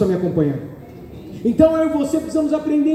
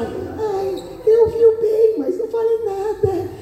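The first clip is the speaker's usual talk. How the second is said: much higher, simpering put-on voice; meek sly wife; 10 semitones higher, slower than usual. Preacher mimicking the sly wife who stays silent, high simpering voice. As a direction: coo it like a wife hiding what she saw. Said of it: "Mas a sonsa não".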